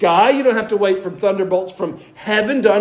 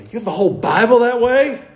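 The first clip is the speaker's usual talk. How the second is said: higher, incredulous rising question; amazed; 4 semitones higher than usual. Acted. Imagined telegraph-era listener hearing about phone Bibles, amazed question. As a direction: echo it in astonishment, rising at the end. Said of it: "they would be amazed".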